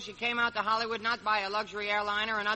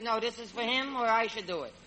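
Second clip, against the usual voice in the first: usual speaking voice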